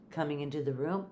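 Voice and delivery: ordinary delivery, nothing marked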